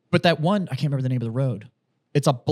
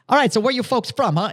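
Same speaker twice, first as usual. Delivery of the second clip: Boston cabbie, imitated higher and brisk; hammed-up Boston accent; full Boston accent; 9 semitones higher, 3 dB louder than usual. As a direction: lay on a Boston driver's greeting. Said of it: "like, full fucking".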